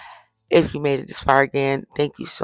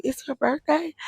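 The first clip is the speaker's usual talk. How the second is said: surprised question; 11 semitones higher, 5 dB quieter than usual. Retold or imitated. Gentleman surprised at register.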